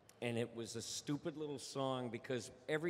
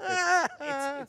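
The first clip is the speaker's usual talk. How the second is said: laughing; 14 dB louder than usual. Real, not acted. Laughing burst after the bit.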